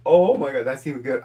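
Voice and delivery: loud delighted burst. Real, not acted. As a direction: cry out in delight. Laughing reaction to joke.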